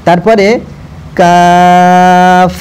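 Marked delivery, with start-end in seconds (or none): 1.2-2.5 কাফ said light and thin, held very long; কাফ হালকা করে টেনে; light drawn kaf. Shown as a demonstration. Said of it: "একদম হালকাভাবে বলবেন".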